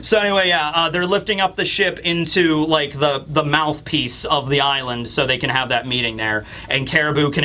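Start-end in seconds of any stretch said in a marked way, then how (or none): none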